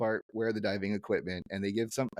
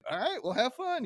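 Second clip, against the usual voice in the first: high chirpy friendly neighbor; brightly pitched-up cheer; Flanders; 13 semitones higher than usual. Retold or imitated. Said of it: "He's like".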